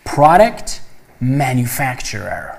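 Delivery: پروداکت with an open "a", pra-dekt, then slow مانیفکتچرر; pronunciation demo. Shown as a demonstration. Teacher repeating the term for students, slow and deliberate. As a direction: model the word slowly for the class. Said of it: "به تلفظش دقت کنید".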